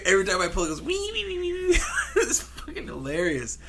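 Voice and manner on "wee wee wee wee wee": much higher squeaky wee wee wee; squealing engine imitation. Mimicking the broken mower to a laughing friend, high squeal. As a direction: squeal like a dying pull-start.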